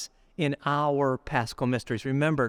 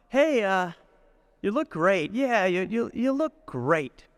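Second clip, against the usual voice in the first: forced cheer; 5 semitones higher, slower than usual. Retold or imitated.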